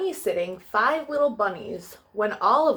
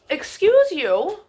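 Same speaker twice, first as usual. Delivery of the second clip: mock scolding; 7 semitones higher, 6 dB louder, slower than usual. Real, not acted. Scolding dog mid-reading.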